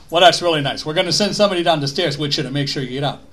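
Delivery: imitated New York firefighter, rushing the offer; loud-starting, quick New York accent; heavy New York accent. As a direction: rattle it off in broad New Yorkese. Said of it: "in this heavy New York accent".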